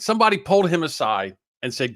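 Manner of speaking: ordinary talk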